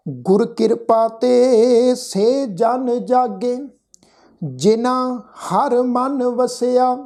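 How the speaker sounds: slow, measured Gurbani recitation with drawn-out vowels; scripture recitation. Shown as a demonstration. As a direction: recite the sacred line word by word, stretching the endings. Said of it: "ਪਾਠ ਉਚਾਰਨ ਕੀਤਾ". (ਹੌਲੀ, ਲਮਕਾ ਕੇ ਗੁਰਬਾਣੀ ਪਾਠ)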